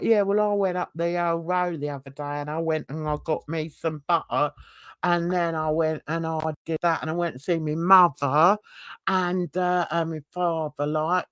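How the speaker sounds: lazy Essex twang, T's dropped; Essex London twang. Acted. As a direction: talk like a Londoner who drops their T's. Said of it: "a sort of twang on it".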